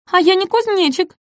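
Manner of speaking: very high, protesting cry; indignant exclamation. Acted. Ком возмущённо восклицает. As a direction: object hotly. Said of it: "воскликнул компсогнат Ком".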